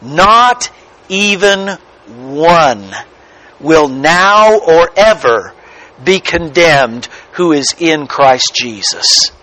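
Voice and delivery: slow, separated "Not even one", pause after it; deliberate phrases with weighty pauses; emphatic restatement. Shown as a demonstration. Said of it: "We could say it like this".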